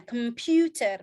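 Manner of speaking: "Computer" with the middle syllable PU stressed. Shown as a demonstration. Teacher showing where stress falls, "Computer" punched on PU. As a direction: lean on the PU in "Computer". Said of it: "ada stresnya".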